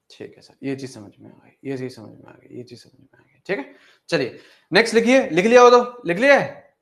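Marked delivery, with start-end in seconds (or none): 0.1-3.4 student voice trailing down to a near whisper; soft mock student murmur dropping to a whisper; fading student voice. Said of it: "voicing student, fading". 4.1-6.7 calling out. Real, not acted. Teacher calling class to write.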